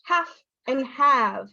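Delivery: HALF short, HAVE long; slow demo, short HALF then lengthened HAVE; vowel length contrast. Shown as a demonstration. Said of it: "difference in the vowel length".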